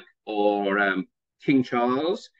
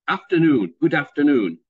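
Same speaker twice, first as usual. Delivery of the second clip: afternoon, said in a louder northern English accent; Good afternoon as a northerner says it; 5 dB louder than usual. Shown as a demonstration. Teacher showing a northern English accent, louder. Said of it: "people would say".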